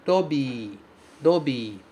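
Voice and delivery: "robi." with a tapped R and a long i; Japanese robii. Shown as a demonstration. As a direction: model "robi." with the flap and a long bi. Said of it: "esse bi é prolongado". ("robi" com R batido e i longo)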